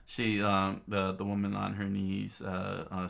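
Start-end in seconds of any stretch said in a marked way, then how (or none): none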